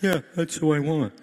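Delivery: lightly voiced God. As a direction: voice God shrugging. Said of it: "God, you know".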